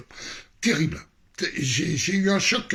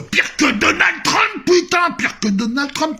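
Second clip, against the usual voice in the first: plus haut, plus fort et plus lent, crié avec fougue; cri exalté; 10 semitones higher, 6 dB louder, slower than usual. Real, not acted. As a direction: lance le nom de Trump en s'emballant. S'emballe en évoquant Trump. (il crie, emporté par son récit, pire que Trump)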